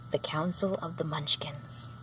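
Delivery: hushed, breathy title read; whispered title. A narrator's reading. Narrator whispering the chapter name to listeners.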